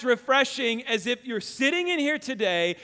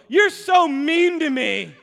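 put-on whiny kid complaint; 5 semitones higher, 5 dB louder, slower than usual. Son complains about being refused.

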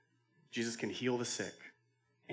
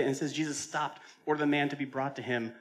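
ordinary talk, nothing marked

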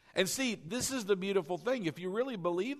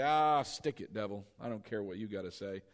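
dismissive; 8 semitones lower, 3 dB quieter than usual. Acted. Acted dismissal of the devil.

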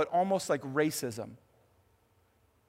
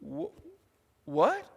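high, stammered disbelief; a woman caught off guard; stunned; 12 semitones higher than usual. Retold or imitated. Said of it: "I think she's like".

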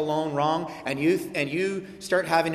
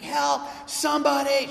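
crying for help; 10 semitones higher than usual. Retold or imitated. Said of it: "she's like".

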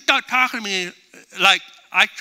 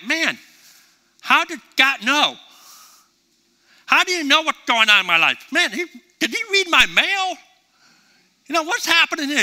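raised, marvelling voice; amazed churchgoer; 8 semitones higher, 3 dB louder than usual. Retold or imitated. Churchgoer stunned by the sermon, raised amazed voice. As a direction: wonder aloud, higher and a bit louder. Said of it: "you're probably like".

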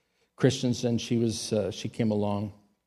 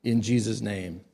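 normal talking voice

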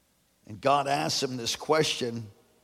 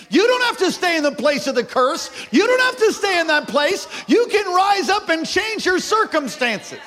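high, loud shouted preaching; rally the congregation, full voice; preaching at full force; 12 semitones higher, 7 dB louder than usual. Acted. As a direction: pastor building to a shouting climax.

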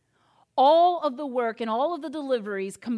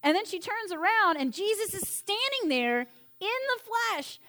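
ordinary talk, nothing marked

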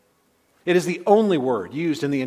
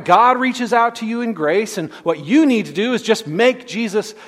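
louder mocking paraphrase; 5 semitones higher, 7 dB louder than usual. Repeats the view with more force.

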